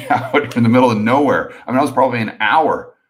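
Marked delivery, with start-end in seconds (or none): 0.0-1.5 words broken by a rueful laugh; chuckling, half disbelieving; laughing through the words. Retold or imitated. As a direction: laugh at your own desperation as you say it. Retelling desperate plan, laugh heard.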